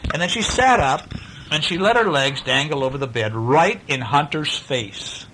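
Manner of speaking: ordinary delivery, nothing marked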